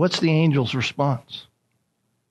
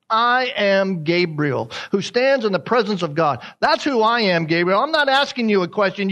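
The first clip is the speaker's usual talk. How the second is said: insistent, with weight on "I" and "That's"; firm statement; 4 semitones higher than usual. Acted. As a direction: declare "I am Gabriel" as settled fact. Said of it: "He's making a statement".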